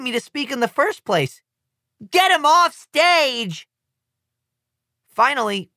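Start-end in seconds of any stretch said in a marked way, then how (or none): 2.1-3.7 very slow, loud, high yell; shouting. A narrator's reading. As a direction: yell him off the stage. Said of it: "shouts the American representative".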